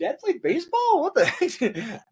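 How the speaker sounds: high-pitched mock disbelief; re-enacted shock at a discovery; incredulous surprise. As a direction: blurt it out high, astonished and amused. Retelling surprise at Dad's trophy.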